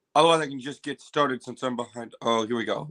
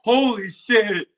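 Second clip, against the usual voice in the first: amazed; 5 semitones higher, 6 dB louder, slower than usual. Real, not acted. Reacting to trailer.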